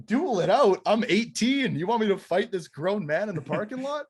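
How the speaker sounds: high, loud indignant questions; outraged disbelief. Retold or imitated. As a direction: re-enact being appalled at the idea. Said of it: "It was just outrage".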